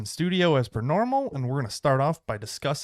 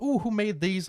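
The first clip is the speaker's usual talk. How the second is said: high, curious coo; mock oohing over something; imitated admiring guest; 10 semitones higher than usual. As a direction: play an impressed guest, pitched up. Voicing admiring guests at party.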